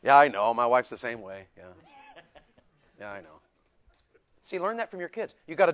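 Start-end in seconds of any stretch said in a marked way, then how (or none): 0.0-3.4 low, deadpan imitation of a guy; mock buddy talk, dropping lower as it goes; guys chatting. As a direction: imitate men agreeing with minimal feeling. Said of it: "guys don't do that".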